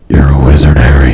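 low whispered modulated Batman rasp; Batfleck voice. Pretend. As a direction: growl it through a voice changer. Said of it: "That's actually pretty spot on".

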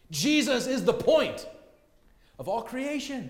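high, loud preaching cry; emphatic proclamation. Real, not acted. Preacher declaring the point of all creation, emphatic proclamation.

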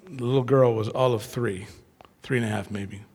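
ordinary talk, nothing marked

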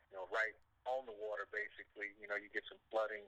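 normal talking voice

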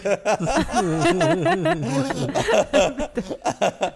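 long high-pitched laugh; high burst of laughter. Real, not acted. Laugh at story.

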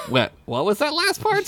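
high teasing question; teasing smile. Acted. Squeak teases Derek about his slip, with a smile. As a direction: smile as you needle him. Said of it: "Squeak said with a smile".

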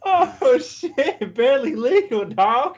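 high, loud fake surprise; mock shock. Real, not acted. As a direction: yelp it out in mock disbelief.

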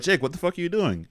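scolding principal. Retold or imitated. Principals scolding Jake, acted out.

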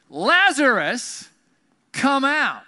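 loud, high, drawn-out shout; commanding call. Acted. Jesus calling dead Lazarus out of the tomb, commanding shout. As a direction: call out slowly across a distance.

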